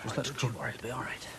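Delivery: soft reassuring whisper; calm her with a hush; soothing whisper. Acted. Husband soothes wife in ambulance.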